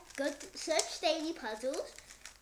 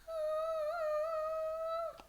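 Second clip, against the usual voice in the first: delighted opening a present. Real, not acted. Excited squeal unboxing new cube.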